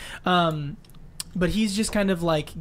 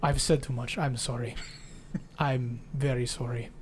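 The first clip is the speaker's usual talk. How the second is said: put-on Russian accent, apologetic; 4 semitones lower, 6 dB quieter than usual.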